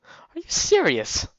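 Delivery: high, loud disbelief, slowing on the last question; incredulous. Real, not acted. Player reacting to hero lying until night, incredulous. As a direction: rise into a squeal of disbelief.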